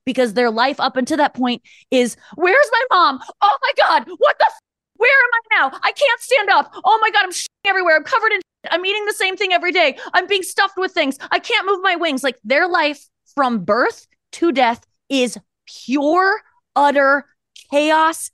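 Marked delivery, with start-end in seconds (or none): 2.3-12.2 high, frantic animal voice, much higher; panicked chicken. Pretend. Speaker voicing a chicken's panicked life, high and frantic. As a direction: squeal it out as a terrified caged chicken.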